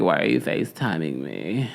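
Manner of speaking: low mocking fry voice; vocal fry. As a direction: drag it out in lazy fry. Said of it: "But the vocal fry".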